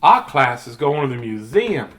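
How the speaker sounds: playful drawl of a southern lady. Acted. Reader giving Fancy Nancy her voice, southern lady. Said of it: "talking like a southern lady".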